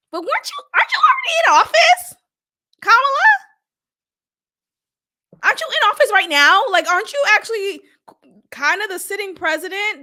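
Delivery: loud, high-pitched disbelieving questions; incredulous challenge. Acted. Host grilling an absent candidate already in office, incredulous. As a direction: confront them, voice jumping way up.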